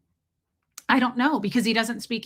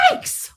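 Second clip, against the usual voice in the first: high-pitched startled yelp; comic reenacted scream of fright; re-enacted startled shriek; 5 dB quieter than usual. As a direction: shriek as if jumping back. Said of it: "Like, that's what I did".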